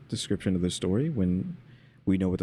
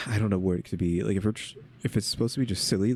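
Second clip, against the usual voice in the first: ordinary talk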